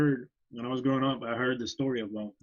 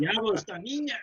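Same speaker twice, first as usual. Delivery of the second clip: laughing mock mother; 8 semitones higher than usual. Voicing mother in Spanish, laughing.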